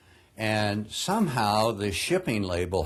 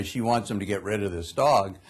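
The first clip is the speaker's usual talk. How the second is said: ordinary delivery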